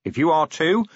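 rally the tired troops, plain-spoken and insistent; commander rallying officers. Acted. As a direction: watch commander's firm pep talk. Narrator voices Vimes addressing officers.

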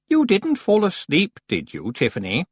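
sing-song, falsely playful. Acted. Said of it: "in what she probably thought was a playful voice".

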